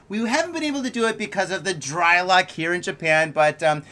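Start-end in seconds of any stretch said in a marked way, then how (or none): none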